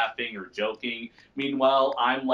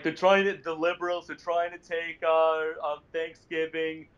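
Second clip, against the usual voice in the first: higher, blustering Trump voice; Donald Trump impression; 4 semitones higher than usual. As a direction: brag like a rally speaker. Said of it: "[name]' Donald Trump impression".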